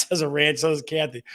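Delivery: ordinary talk, nothing marked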